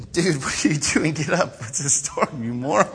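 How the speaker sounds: lightly voiced laid-back captain scolding; a hint of a bored sailor calling him a moron; mild mock annoyed captain; casual captain. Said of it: "And so the captain came to him and said".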